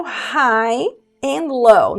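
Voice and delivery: lyric read slowly for students; slow demo. Shown as a demonstration. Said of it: "slow demo of lyric".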